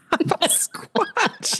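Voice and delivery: very high, slow and drawn out; delighted high drawl; amused. Real, not acted. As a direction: savour the silly name.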